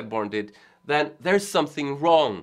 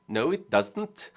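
imitated Swedish accent, slow and higher; Johan Segerborn; 5 semitones higher, slower than usual. Imagining the video maker's answer, in a Swedish accent. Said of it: "say it in his Swedish accent".

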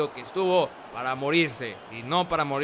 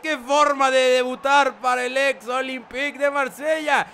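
soaring, much louder and higher play-by-play shout; excited goal call; 11 semitones higher, 8 dB louder, slower than usual. Acted. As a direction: call the goal like a stadium commentator going wild. Live goal call in commentary.

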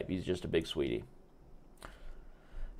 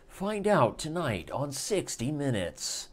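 slow, weighty anchor sign-off; dramatic promo tagline; 6 semitones higher, slower than usual. Pretend. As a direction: land the tease like a TV announcer.

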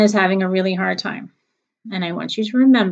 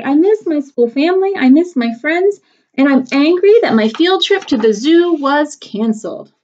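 put-on higher, louder upset child; crying complaint; 7 semitones higher, 6 dB louder than usual. Sophie complaining tearfully about missing school. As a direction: whine it out like a little girl close to tears. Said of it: "She cried".

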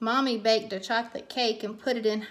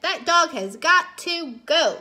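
higher, louder, punched exasperation; fed-up mom; 5 semitones higher, 6 dB louder than usual. A narrator's reading. Mommy orders the dog out, emphatic and fed up. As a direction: hit each word like a final verdict. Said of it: "Mommy said".

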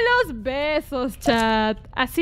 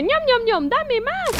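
higher-pitched munching imitation; hungry chomping, voiced higher; hungry game character; 7 semitones higher, slower than usual. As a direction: translate it as a playful greedy eater.

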